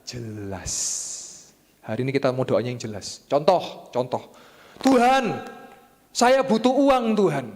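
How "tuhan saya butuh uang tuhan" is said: loud, high, drawn-out mock plea; hammed-up prayer, raised and stretched; demanding prayer. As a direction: call out to God in an acted plea. Acts out an example prayer.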